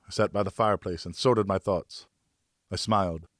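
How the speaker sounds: ordinary delivery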